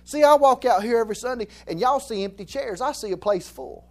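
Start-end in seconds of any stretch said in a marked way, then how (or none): none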